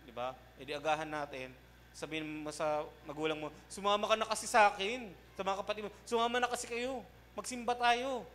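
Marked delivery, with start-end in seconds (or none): 3.7-8.3 pleading invitation. Retold or imitated. Pleading with parents to attend church.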